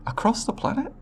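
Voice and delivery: high, rising astonished question; can hardly believe the scale; incredulous. Acted. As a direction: pitch leaps up in disbelief. Alien amazed that settlements share resources.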